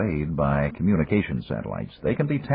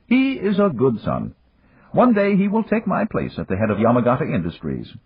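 higher, unhurried voice of an amused magnate; Yamagata; 8 semitones higher than usual. A narrator's reading. Yamagata chiding Dan for the early call, higher and amused. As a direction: tease an old friend from a position of power.

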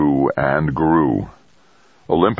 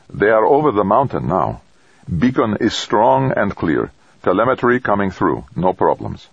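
brisk, terse report; curt and businesslike; 5 semitones higher than usual. A narrator's reading. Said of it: "say tersely".

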